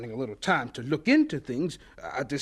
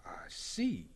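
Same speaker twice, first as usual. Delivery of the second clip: low, very soft and taken aback; subdued reaction; 10 semitones lower, 12 dB quieter than usual. Acted. Carver hears the newcomer joins his department, subdued. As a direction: let it drop almost under your breath.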